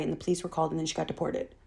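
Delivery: usual speaking voice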